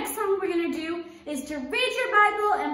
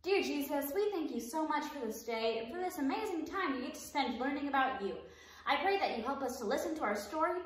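soft, unhurried prayer; 9 dB quieter than usual. Acted. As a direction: pray gently for the class. Leads a prayer for the kids.

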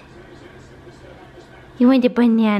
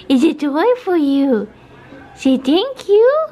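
talking to a pet; 5 semitones higher, slower than usual. Real, not acted. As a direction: coo it slowly at the dog. Coaxing the dog.

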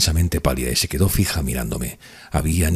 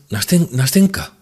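high, urgent calling of her name; high-pitched, faltering excited call; barely contained emotion; 11 semitones higher, 4 dB louder than usual. A narrator's reading. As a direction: stammer out the name, overcome with feeling. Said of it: "sin poder apenas dominar mi emoción".

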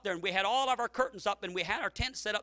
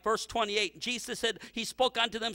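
normal talking voice

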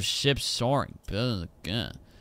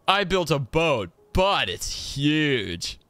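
mock title read; 4 semitones higher, much slower than usual.

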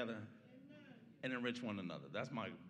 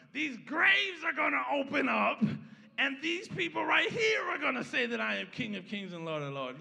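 loud, high climax of the sermon; rising pulpit shout, laughing into it; preaching at full force; 8 semitones higher, 8 dB louder than usual. Acted. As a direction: shout it to the congregation.

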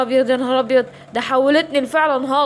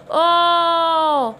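long drawn-out groan; 4 semitones higher than usual. Acted. Injected, becomes Captain America.

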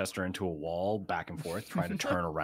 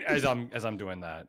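normal talking voice

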